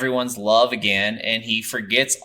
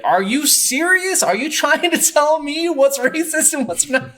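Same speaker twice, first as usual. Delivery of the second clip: much higher, louder, incredulous retelling of the wife; aggressive outrage; 4 dB louder than usual. Retold or imitated. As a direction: fire it back at him in disbelief, aghast. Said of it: "going against everything he was saying so aggressively".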